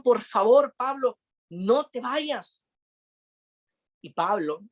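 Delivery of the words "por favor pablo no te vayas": high, slow begging plea; pleading. Retold or imitated. Friends begging Pablo not to go to Jerusalem, high and slow. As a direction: draw it out, begging him to stay.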